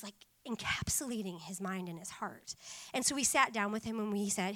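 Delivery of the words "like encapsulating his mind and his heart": hushed, breathy whisper; whispered emphasis. Real, not acted. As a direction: drop to a whisper for weight. Intense aside about son.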